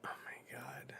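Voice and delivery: awed whisper; a real stunned whisper. Real, not acted. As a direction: breathe it out in awe. Reacting in awe to scene.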